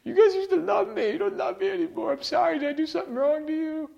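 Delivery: high falsetto mock whine, pouting; squeaky high complaining mock voice; whining Jesus. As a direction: parody a needy Jesus begging his church. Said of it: "he's not crying and whining".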